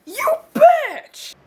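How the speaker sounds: furious shout. Acted. Angry man yelling at his friend, high loud yell. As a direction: scream the insult.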